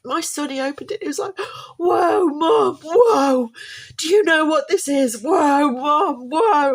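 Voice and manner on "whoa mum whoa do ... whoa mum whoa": much higher, louder, thrilled shouts of "whoa"; a son yelling with delight at his present; excited kid. Retold or imitated. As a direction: son overjoyed at the amplifier, shouting to mum. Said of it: "like this".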